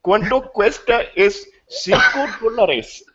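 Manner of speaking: put-on high, laughing Indian accent on Spanish words. Mocking a Spanish phrase for friends, Indian accent. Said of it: "speak Spanish with an Indian accent".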